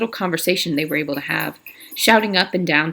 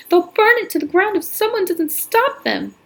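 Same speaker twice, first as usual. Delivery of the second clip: much higher, louder, out of breath from running; breathless panic; 13 semitones higher, 4 dB louder than usual. Acted. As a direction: gasp it out to the sheriff. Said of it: "gasping for breath".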